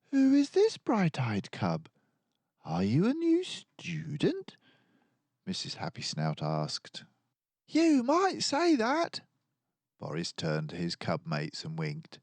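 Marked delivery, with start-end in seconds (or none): 0.1-4.6 high, hushed wondering question; curious teacher. A narrator's reading. Teacher greeting a bright-eyed new cub, curious. 7.7-9.2 much higher, slow sly reply; playful coy. A narrator's reading. Boris answering his teacher, coy and playful.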